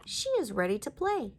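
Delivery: plain reading voice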